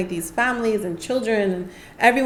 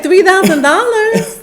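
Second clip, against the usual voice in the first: higher, louder and slow, in disbelief; incredulous; 11 semitones higher, 11 dB louder, slower than usual. Retold or imitated. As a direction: stretch out the shock at the price. Outraged at the fee.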